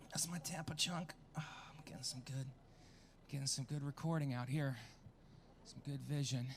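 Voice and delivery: breathy muttered aside, away from the crowd; whispered aside. Real, not acted. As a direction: slip it in under your breath.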